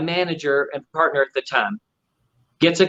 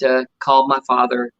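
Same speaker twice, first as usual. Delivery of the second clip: ordinary delivery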